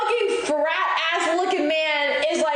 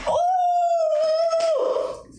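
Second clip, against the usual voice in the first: a sudden, very high outraged "Oh!"; exasperated shriek. Real, not acted. Exasperated at the rude stranger, shrieking "Oh!". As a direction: throw your hands up with a shrill cry. Exasperated exclamation at his rudeness.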